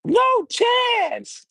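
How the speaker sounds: high, stretched "no chance"; wrestler catchphrase. Retold or imitated. Streamer imitating a wrestler's catchphrase, high and drawn out. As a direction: wail it like the heel boss. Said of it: "He be like".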